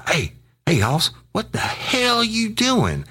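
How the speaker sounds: high, drawn-out incredulous demand; mock incredulous. Colleague phoning to ask what's going on, high-pitched incredulity. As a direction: imitate an agitated caller, voice shooting way up. Colleague's alarmed phone call acted out.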